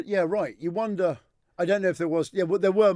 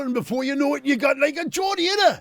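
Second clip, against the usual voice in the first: much higher, climbing mock accent; mock Geordie; 8 semitones higher, 3 dB louder than usual. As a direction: ham up the accent mix. Mocking accent blend.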